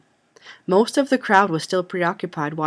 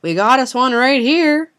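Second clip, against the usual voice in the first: loud, raised-pitch holler to the mob; yelling; 7 semitones higher, 9 dB louder than usual. A narrator's reading. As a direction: holler it out to rally the others. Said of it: "grabbing my sleeve and yelling".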